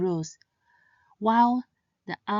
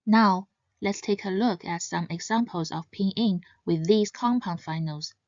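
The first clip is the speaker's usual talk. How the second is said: ordinary talk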